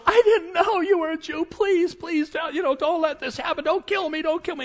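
high, hurried mock begging; desperate pleading. Haman on his knees before Esther, pleading frantically. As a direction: beg for your life, hammed up. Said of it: "He gets on his knees".

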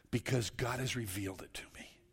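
soft unvoiced whisper; whispered conviction. Real, not acted. Preacher to congregation about faith, whispered. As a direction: breathe it as a confession.

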